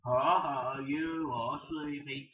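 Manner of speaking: normal talking voice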